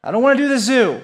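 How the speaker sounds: put-on high, loud childish whine; a kid protesting the zoo trip, whining high and loud; whiny kid. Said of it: "And I said".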